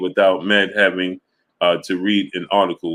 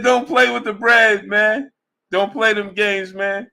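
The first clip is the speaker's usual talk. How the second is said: laughing high quote; 12 semitones higher than usual. Retold or imitated. Quotes Earl again while laughing.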